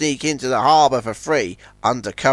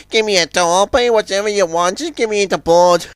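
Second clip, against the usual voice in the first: higher, eager plea; relieved Izzy; 6 semitones higher than usual. A narrator's reading. Izzy asks Zoran for a tow, relieved. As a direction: beg a favour with relief. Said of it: "cried Izzy with relief".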